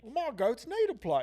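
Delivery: bad pub British accent; mock British accent. [name] pleading for goat space, mock British. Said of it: "our British accents".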